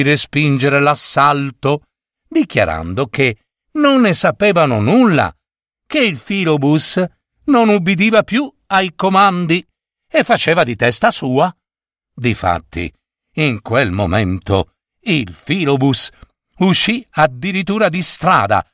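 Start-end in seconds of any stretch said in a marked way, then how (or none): none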